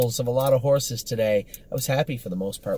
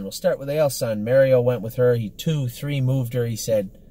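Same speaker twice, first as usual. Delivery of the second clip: ordinary delivery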